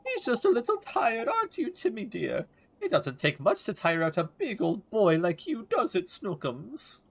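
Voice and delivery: put-on high sugary old woman babying her pet; cooing old lady. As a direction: coo at the cat like a doting granny. [name] cooing to cat.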